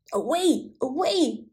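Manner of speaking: hoarse barked warning; barking old dog. Acted. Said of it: "barked the old yard dog".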